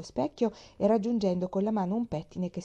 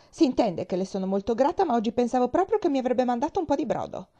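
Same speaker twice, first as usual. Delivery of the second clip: louder, testy complaint; irritated; 5 semitones higher, 5 dB louder than usual. A narrator's reading. Invalid telling the girl she expected broth, irritated. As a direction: grumble loudly in an irritated voice. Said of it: "disse una voce irritata".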